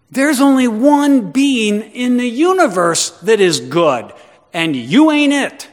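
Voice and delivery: loud, high, slow declaration; full-force preaching. Acted. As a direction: thunder out each phrase with heavy stresses.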